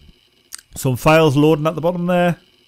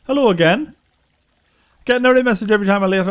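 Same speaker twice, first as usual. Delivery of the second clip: usual speaking voice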